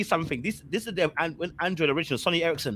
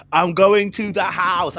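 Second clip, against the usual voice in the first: louder, slower resolve on going; determined; 4 semitones higher, 8 dB louder, slower than usual. Retold or imitated. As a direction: dig in and state the decision. Repeated with resolve.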